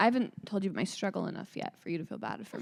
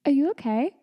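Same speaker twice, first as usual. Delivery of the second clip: raised, high-pitched check-in; alarmed hygienist; 7 semitones higher, 6 dB louder than usual. Retold or imitated. Hygienist checking on an unresponsive patient, alarmed imitation. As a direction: imitate a nurse calling out in concern.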